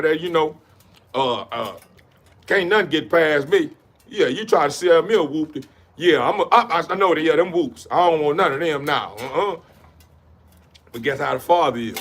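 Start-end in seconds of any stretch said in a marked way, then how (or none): none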